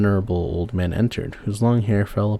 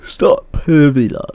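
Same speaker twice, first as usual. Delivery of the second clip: loud, very slow command; a magical father stopping her fall; slow, booming stop; commanding call; 6 semitones higher, 6 dB louder, much slower than usual. A narrator's reading. Fairy father halts her from cloud.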